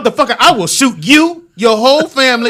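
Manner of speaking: police threat. Pretend. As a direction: yell a cop's threat. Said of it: "I'll be the police".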